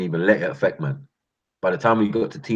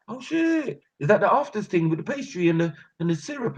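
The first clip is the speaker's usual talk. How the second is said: delighted neighbour; 11 semitones higher than usual. Retold or imitated. Neighbour delighted by food gift.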